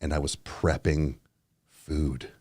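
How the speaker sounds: low, soft hushed voice; hushed storytelling. Real, not acted. Confessing a shroom story quietly to the mic. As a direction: tell it under your breath. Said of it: "we'll talk quietly".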